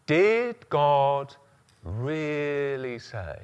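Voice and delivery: slow, soft, sly whisper of doubt; tiny devil's voice. Shown as a demonstration. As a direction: plant doubt quietly, like the serpent. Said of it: "Tiny little voice".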